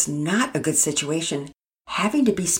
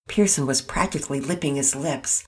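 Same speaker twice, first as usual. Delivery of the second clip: usual speaking voice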